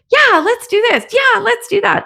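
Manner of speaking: high, pumped-up mock take-charge voice; eager pushy knight. A pushy knight barging in, eager and mock-hyped. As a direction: barge in all raring to go.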